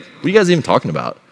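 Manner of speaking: lightly acted feigned ignorance; teasing dad. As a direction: play dumb to tease the kids. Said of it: "'cause I'm a dad, we love dad jokes".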